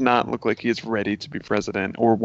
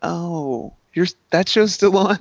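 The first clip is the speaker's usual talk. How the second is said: surprised; 6 semitones higher, 4 dB louder, slower than usual. Retold or imitated. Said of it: "they're like".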